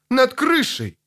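short high-pitched exclamation; crying out. A narrator's reading. Elf shouting where the spirit hovers, high cry. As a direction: call out the sighting, voice lifted high. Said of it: "закричал Хириадан".

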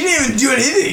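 high, laughing disbelief; exasperated retort. Retold or imitated. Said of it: "I was like".

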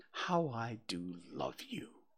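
slow, soft affection; tender. A narrator's reading. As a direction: say it softly and fondly. Boy tells bear he loves him.

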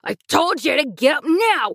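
angry kidnapper hurrying her; mad. A narrator's reading. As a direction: snap at the sleepy girl. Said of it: "Walter got mad and said".

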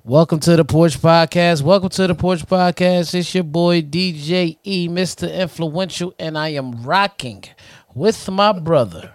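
punchy, rhythmic show opening; podcast announcer. Acted. Host welcoming listeners to the Porch Podcast, announcer delivery. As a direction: announce it in measured beats, leaning on each phrase.

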